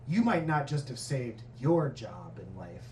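low, soft mock-solemn pronouncement; Steven Spielberg. Spielberg to the girl after reading, mock-solemn praise.

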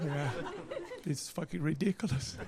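usual speaking voice

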